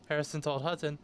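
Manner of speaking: normal talking voice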